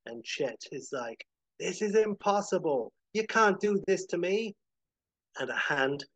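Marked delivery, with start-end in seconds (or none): 1.6-4.5 lightly voiced villain, pitched high; protesting villain. Chet protests to Howard between worlds, disbelieving. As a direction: protest in raised, outraged disbelief.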